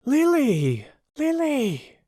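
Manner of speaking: high, worried calls; concerned friends calling. Acted. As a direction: call to her gently in worry. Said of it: "in concern".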